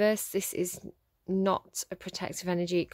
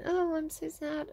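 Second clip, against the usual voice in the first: higher, slowed mock-sad voice; mock sad whine; 7 semitones higher, slower than usual. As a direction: parody a drama queen fishing for pity. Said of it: "kind of going".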